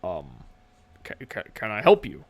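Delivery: low, stammering orc voice; a flustered big orc, stumbling over words; nervous orc clerk. Acted. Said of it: "he's like".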